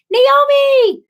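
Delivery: high, loud delighted call of a name; thrilled shout of hello; excited greeting. Real, not acted. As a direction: call out to a friend who just arrived.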